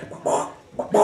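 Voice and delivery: soft breathy clucking bahs; quiet mock chicken clucks; whispered chicken clucks. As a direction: cluck under your breath like a hen. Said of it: "The chicken's like".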